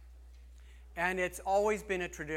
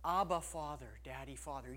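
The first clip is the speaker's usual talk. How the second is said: put-on tender child's call; 5 semitones lower, 6 dB quieter than usual. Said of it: "It cries out".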